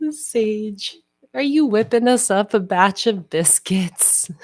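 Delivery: high, airy cooing to the cat; pet talk. Real, not acted. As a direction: coo it to your kitty.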